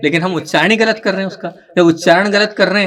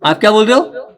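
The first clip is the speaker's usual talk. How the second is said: normal talking voice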